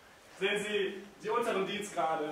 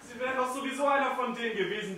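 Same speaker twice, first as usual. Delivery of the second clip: usual speaking voice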